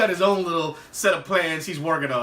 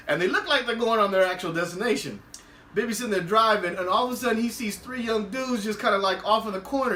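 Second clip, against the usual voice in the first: normal talking voice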